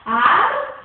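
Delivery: "Árvore" split into ár, vo, re with pauses, first syllable loud. Shown as a demonstration. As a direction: break "Árvore" into three separate syllables, punching the ÁR. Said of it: "Vamos colocar sílaba em sílaba".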